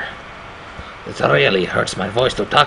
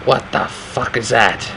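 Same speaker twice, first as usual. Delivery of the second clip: ordinary talk, nothing marked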